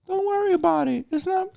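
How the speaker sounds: very high mock dismissive voice; mocking brush-off.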